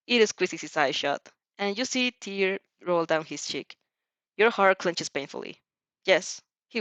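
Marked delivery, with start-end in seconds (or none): none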